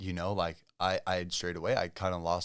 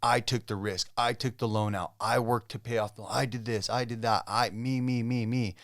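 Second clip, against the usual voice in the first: insistent mock boasting, higher and louder, hitting each "I"; self-centred boasting; 4 semitones higher, 5 dB louder than usual. Husband recalling his rants at his wife, mock boastful. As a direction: imitate your own self-important list of credits.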